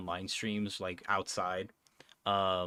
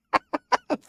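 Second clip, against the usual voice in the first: shrieking laugh; 10 dB louder than usual. Real, not acted. Laughs at Badger mistake.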